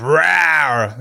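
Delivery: weak lion roar attempt. Real, not acted. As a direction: give a little lion rawr. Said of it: "That was a terrible".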